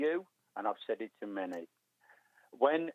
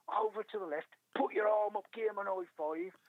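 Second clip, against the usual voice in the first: higher-pitched retold instructions; imitating running guides; 9 semitones higher than usual. Lads directing the blind runner along the course, imitated calls. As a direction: act out the guides calling directions.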